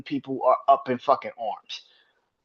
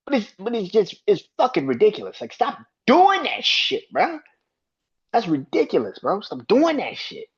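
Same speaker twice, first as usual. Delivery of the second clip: real exasperation; 7 semitones higher than usual. Real, not acted. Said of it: "That pissed me off last night".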